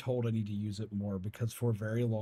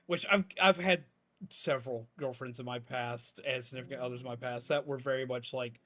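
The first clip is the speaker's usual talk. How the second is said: higher, louder, bright-on streamer persona; peppy streamer voice; 4 semitones higher, 5 dB louder than usual. Acted. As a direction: switch into the upbeat voice for the stream. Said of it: "the happy, peppy voice".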